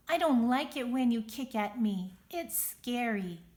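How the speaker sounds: a small worm finding her big voice. Acted. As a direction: stand up for yourself out loud. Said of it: "I can use my big voice".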